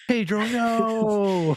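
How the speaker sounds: very slow, high mock lament; drawn-out wail. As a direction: stretch it into a wail of grief. Dismay at Pedro being villain.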